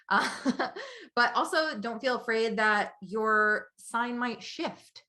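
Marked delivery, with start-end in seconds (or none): 0.3-1.1 high little laugh; amused laugh. Real, not acted. After thanking the sign, high amused laugh. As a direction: chuckle at your own bit.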